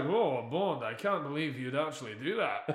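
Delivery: put-on light Scottish accent on a Russian villain line; Scottish accent slipping. Mocking the actor's slipping Russian accent, light Scottish lilt. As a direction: let a mild Scottish lilt slip through. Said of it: "he just speaks Scottish".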